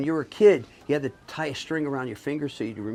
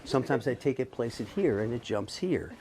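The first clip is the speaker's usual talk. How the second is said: ordinary talk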